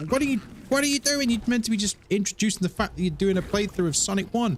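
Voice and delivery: high-pitched exasperated telling-off; imitating himself losing patience, pitched high; mock self-scolding. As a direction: berate yourself in disbelief. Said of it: "cussing myself out".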